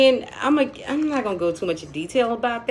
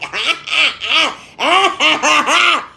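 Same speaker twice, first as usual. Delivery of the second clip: loud, high mock cackling; imitated loud laughter; 7 semitones higher, 8 dB louder, slower than usual. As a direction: imitate two people laughing loudly. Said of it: "hear both of 'em talking loud".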